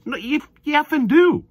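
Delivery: high, loud exasperated outburst; exasperated retort. Acted. Arguing back at a player who claims not to care, exasperated. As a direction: snap back loudly, pitch shooting up.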